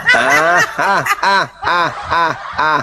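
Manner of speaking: mock laughter. Real, not acted. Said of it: "Bang Juma ketawa menutupi malu ya".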